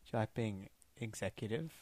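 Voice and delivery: hushed, secretive recruiter voice; stage whisper. Acted. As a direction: lean in and whisper the offer. Recruiting Anthony into Spectre.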